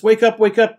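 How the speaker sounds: high, loud wake-up call; shouting. A narrator's reading. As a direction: shout to rouse a sleeper. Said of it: "they shouted".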